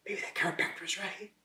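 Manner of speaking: hushed, reluctant admission; whispered self-talk. Retold or imitated. Patient admitting to himself he needs surgery, whispered. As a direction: whisper it to yourself as it dawns on you. Acting out his inner admission.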